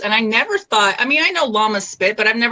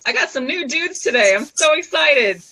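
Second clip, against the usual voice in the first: ordinary talk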